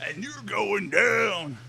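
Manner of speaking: wrestling promo shout, drawn out; Macho Man Randy Savage. Retold or imitated. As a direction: call out your rival in the ring. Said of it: "Macho Man Randy Savage impression".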